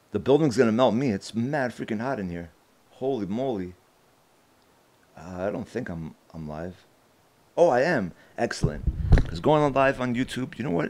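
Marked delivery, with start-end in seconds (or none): none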